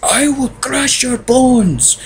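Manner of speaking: high, drawn-out villain threat; Bane. Acted. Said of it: "You know, that was Bane".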